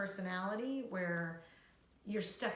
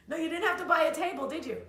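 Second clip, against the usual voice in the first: higher, louder, fast; mock disbelief; 9 semitones higher, 7 dB louder, much faster than usual. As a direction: gush in fake amazement.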